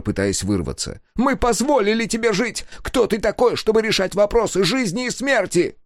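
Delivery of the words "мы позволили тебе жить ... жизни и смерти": high, indignant accusation; raging engineer. A narrator's reading. Engineer raging at Sega, high and indignant. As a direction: demand who he is to judge.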